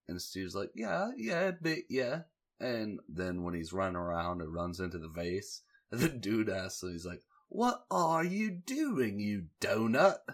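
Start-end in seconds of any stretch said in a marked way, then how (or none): 0.7-2.2 pitched way up, sheepish yeah; high squeaky reply. Retold or imitated. Steven admits to the guard he's crying, voice pitched high. 7.5-10.2 high, slow mock British "what are you doing"; mock British guard. Guard scolds Steven for running into the vase, mock British. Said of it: "which I may have to steal for my day-to-day roasting".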